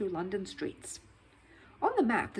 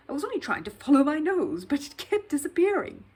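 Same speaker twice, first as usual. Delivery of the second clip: higher, louder earnest bear voice; lightly voiced bear; 8 semitones higher, 8 dB louder than usual. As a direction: explain innocently, a bit flustered. Paddington explains his fall.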